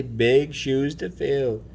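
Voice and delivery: ordinary talk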